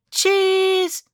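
high, held-out "Cheese"; one long, high, level note; photo-pose cheese. Acted. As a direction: sing it out high for the camera. Posing for photo in story.

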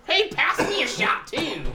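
high, drawn-out mimicry; goofy high-pitched drinker voice; mock party drinker. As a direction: butt in like a silly drunk podcast guest. Mimics drinkers ruining a podcast.

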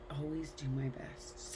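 soft, slow reluctant echo; grudging mumble. Retold or imitated. Listener echoing the rule, soft and slow. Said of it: "And you're like".

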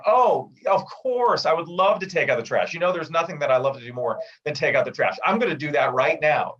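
over-the-top fake enthusiasm for chores; mocking eager yes to the wife, laying it on thick; sarcastic. Shown as a demonstration. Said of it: "sarcastic and say".

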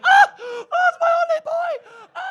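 hammed-up, piercing high hysterical wail; mock shriek of maternal grief, very loud; wailing mom. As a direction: imitate a distraught mother screaming out. Imitates his distraught mom wailing.

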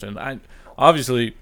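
ordinary talk, nothing marked

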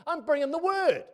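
high, loud declaration; triumphant preacher cry; defiant. Acted. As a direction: proclaim it boldly.

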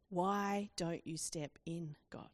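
slow, low, quiet plea to God; hushed lament, drawn out slowly; pleading cry to God. Acted. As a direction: cry out to God, softly and wearily. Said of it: "Habakkuk cries out".

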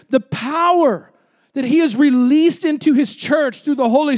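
forceful preaching. Real, not acted. Preacher declaring the church's power to the congregation, forceful preaching.

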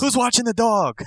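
very high put-on woman's voice; fretting wife. Wife questioning husband about the kids, mock panicked squeal. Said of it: "Can you imagine her, like".

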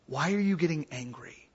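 quiet, low, composed question; cool, calm, collected. Shown as a demonstration. Teacher models questioning an angry person, calm and hushed. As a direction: ask it softly, giving the anger nothing back. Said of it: "see how I say it cool, calm, and collected".

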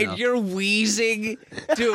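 high, slow squeaky disbelief; high mock outrage. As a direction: squeak it out in fake shock.

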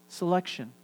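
ordinary talk

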